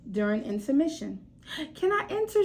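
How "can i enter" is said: put-on 1 eager little girl. Child Florence asks eagerly.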